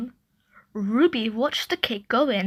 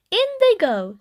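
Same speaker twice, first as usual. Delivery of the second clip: put-on high little girl echo; toddler echo; 9 semitones higher, 3 dB louder than usual. A narrator's reading. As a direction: copy Mum in a bright small-child voice. Ruby echoing Mum.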